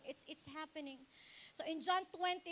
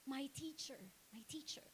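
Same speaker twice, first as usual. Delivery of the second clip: soft, much lower whisper; awed whisper; 3 semitones lower, 9 dB quieter than usual. Acted. Preacher whispering Mary's words to Jesus. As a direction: whisper it in wonder. Mary's recognition, retold softly.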